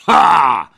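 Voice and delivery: loud, high battle roar. Acted. Red knight answers the challenge, roaring. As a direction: roar one explosive syllable. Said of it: "ryknął czerwony rycerz".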